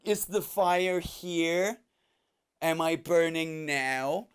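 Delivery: slow, drawn-out mock exasperation; imitated annoyed woman. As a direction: stretch each question out, put-upon and hammed up. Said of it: "And she's like".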